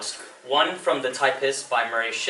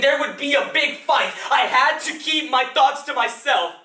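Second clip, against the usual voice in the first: higher, insistent outpouring without letup; pent-up anguish; 11 semitones higher, 5 dB louder than usual. Acted. Grown child caught between warring parents, anguished raised voice. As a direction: let the old frustration build and spill out.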